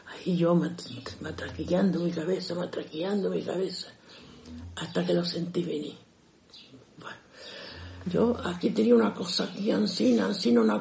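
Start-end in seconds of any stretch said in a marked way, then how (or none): none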